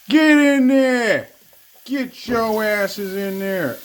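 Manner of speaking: slow, drawn-out order to the onions; bossing the onions. Pretend. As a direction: boss them into the pan.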